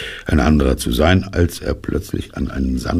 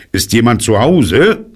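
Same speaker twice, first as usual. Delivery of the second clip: higher, louder call with rising question; 7 semitones higher, 6 dB louder than usual. A narrator's reading. Bear calls into a rabbit hole, calling out. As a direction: call down a hole to whoever's home. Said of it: "steckte seinen Kopf in das Loch und rief".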